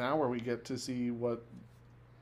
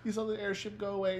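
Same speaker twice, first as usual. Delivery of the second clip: ordinary talk, nothing marked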